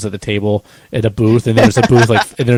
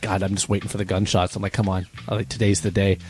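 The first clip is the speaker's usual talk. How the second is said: soft, offhand aside to oneself; quiet, understated mock anticipation; retold muttering; 2 semitones lower, 8 dB quieter than usual. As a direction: drop the volume as if talking to yourself. Retells muttering to himself while waiting.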